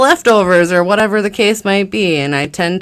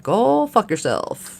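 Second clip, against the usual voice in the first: dismissive; 5 semitones higher, much slower than usual. Retold or imitated. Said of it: "sometimes I'm like".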